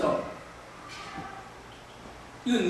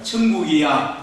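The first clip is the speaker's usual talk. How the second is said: call for repetition; 7 semitones lower, slower than usual. Shown as a demonstration. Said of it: "한번 따라해 봅시다".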